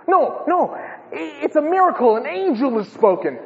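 high, stammering contradiction; insistent bystander. Acted. Another bystander disputes thunder.

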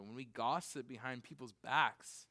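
usual speaking voice

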